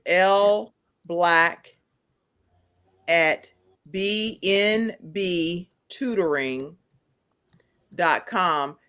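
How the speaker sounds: very slow, spaced-out words for note-takers; drawn-out, deliberate read-out of an address; slow dictation. Real, not acted. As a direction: dictate the address piece by piece, with pauses. Dictating payment address for listeners.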